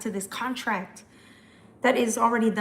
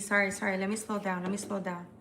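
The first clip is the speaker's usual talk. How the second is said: ordinary talk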